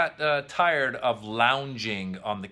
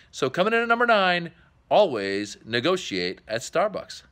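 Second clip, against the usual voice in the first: announcer hype; 4 semitones higher than usual. Acted. Host introducing the next countdown video, announcer hype. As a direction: sell the number like a host. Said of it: "countdown host announcement".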